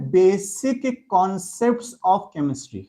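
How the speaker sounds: dictating the heading very slowly, word by word; slow dictation. Shown as a demonstration. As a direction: spell out each word so students can write it. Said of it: "chapter की heading देंगे सबके सब".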